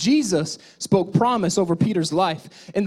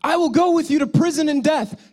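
higher, louder proud vow; boastful declaration; 9 semitones higher, 5 dB louder than usual. Acted. Preacher mimics Peter boasting to Jesus, loud and proud. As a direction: belt out Peter's brave promise.

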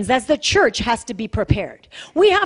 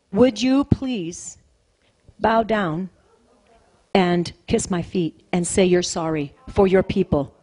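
retold measured demand; 8 semitones lower than usual. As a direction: spell out the demand in pieces, low and unhurried. Retold demand to a frightened woman.

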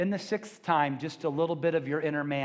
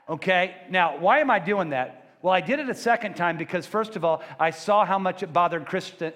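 ordinary delivery

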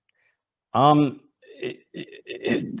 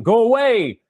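loud, higher cry of the demon; demon's shout; 6 semitones higher, 4 dB louder than usual. A narrator's reading. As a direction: hurl it out as the possessed man. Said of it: "cried out, shouting".

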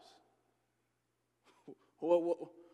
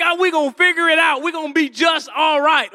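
loud, higher cocky dad; hammed-up overconfident father; mock confident boast; 5 semitones higher, 12 dB louder than usual. New dad boasting loudly to the parenting class.

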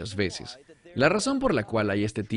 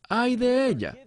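high-pitched retold wail of woe; an understated imitation of wailing; mock lament; 13 semitones higher than usual. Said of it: "se lamentan".

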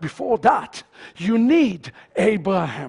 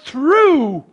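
"true" stretched long, pitch arching then falling; stress on true; 3 semitones higher than usual. Shown as a demonstration. Preacher singling out one word, heavy stress on "true". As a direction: draw out "true" as the key word. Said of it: "I want to stress this word".

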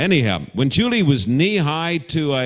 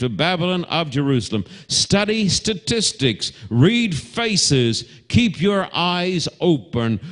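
raised, slow pulpit declamation; full oratory; 5 semitones higher than usual. Acted. Preacher rousing the congregation with raised oratory. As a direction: proclaim it slowly from the pulpit.

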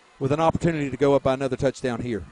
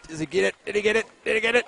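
anxious; 7 semitones higher, faster than usual. Real, not acted. Goal line play in doubt.

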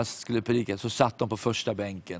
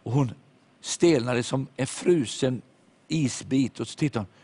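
normal talking voice

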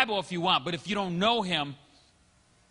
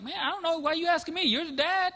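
lightly voiced exasperation, pitched well higher; incredulous retort; 11 semitones higher than usual. Pastor telling his camping buddy, mock exasperated. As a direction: throw the question back at him. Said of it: "I'm like".

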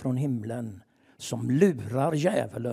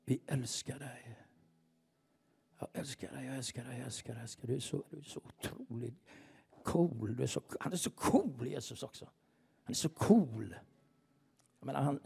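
very soft praise; whispered worship; 9 dB quieter than usual. Real, not acted. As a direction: breathe your love to Jesus. Intimate worship prayer.

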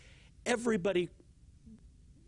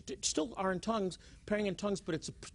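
ordinary talk, nothing marked

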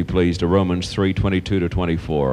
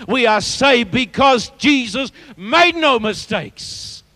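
climbing in pitch to a ringing climax; impassioned, punchy sermon delivery; building preaching; 12 semitones higher, 3 dB louder than usual. Real, not acted. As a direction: answer the objections with rising force.

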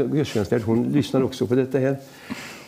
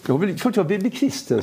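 higher-pitched, retold eager yes; 7 semitones higher than usual. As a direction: imitate a keen acceptance, pitch lifted. Repeats old man's eager acceptance.

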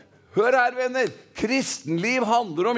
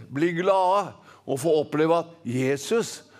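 usual speaking voice

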